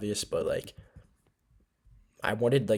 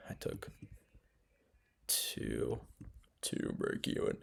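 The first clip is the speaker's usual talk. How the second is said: sleepy whisper; drowsy, breathy half-whisper; 10 semitones lower, 8 dB quieter, slower than usual. Real, not acted. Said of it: "Guys, this is my sleepy voice".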